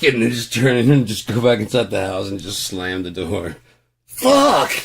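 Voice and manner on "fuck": anguished scream. Acted. Screaming again, slams door.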